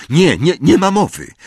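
high, loud burst of refusal; loud protest. A narrator's reading. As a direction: cry out a firm protest. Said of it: "krzyknął głośno". (głośny, wysoki protest)

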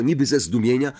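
ordinary delivery, nothing marked

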